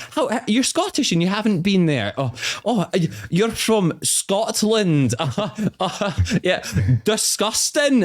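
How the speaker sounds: high-pitched imitation of disbelief; mock outrage. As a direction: act scandalised, stretching it out high. Acting out a shocked woman's reproach.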